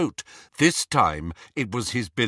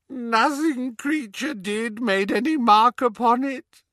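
moaning elf; 12 semitones higher than usual. Acted. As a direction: groan the words out in misery. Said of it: "moaned the elf".

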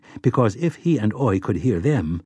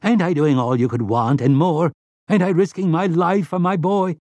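higher, injured pleading; almost whiny; 7 semitones higher than usual. A narrator's reading. Slightman pleads that he risks his life, whiny. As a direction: plead your case with a whine. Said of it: "almost whiny".

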